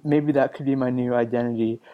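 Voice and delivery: ordinary talk, nothing marked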